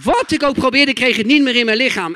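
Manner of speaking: usual speaking voice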